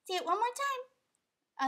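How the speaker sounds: high slow coaxing voice; coaxing the dog. Pretend. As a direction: plead sweetly with the puppy.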